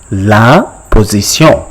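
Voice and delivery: "La position" as French la po-zee-syon; French pronunciation. Shown as a demonstration. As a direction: model "La position" with nasal -syon. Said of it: "how do you call it in French".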